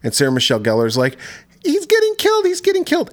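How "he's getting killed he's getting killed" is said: high, panicky squeal of alarm; hammed-up high panic; mock panic. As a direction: mimic a frantic girl pointing upward. Retelling Sarah Michelle Gellar's panic.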